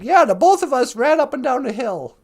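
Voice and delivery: brisk Minnesotan paraphrase; Minnesota accent. Acted. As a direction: put on a folksy Minnesota accent. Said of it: "Minnesota person said".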